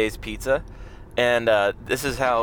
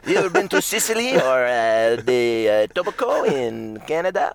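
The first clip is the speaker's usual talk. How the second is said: higher Quebec guy voice; Quebec accent; 3 semitones higher than usual. Pretend. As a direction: play a Quebec immigrant lightly. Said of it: "From Quebec".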